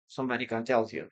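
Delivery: normal talking voice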